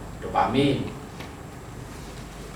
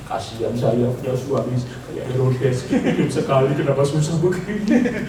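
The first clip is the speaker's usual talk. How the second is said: self-pitying whine; 2 semitones lower, slower than usual. Retold or imitated. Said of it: "mengasihani diri sendiri".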